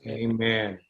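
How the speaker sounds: usual speaking voice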